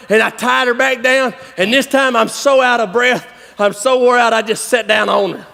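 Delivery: usual speaking voice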